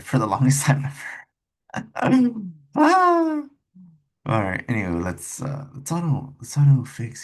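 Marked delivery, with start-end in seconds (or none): 0.0-3.4 laughing. Real, not acted. Laughs at himself.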